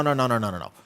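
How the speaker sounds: ordinary talk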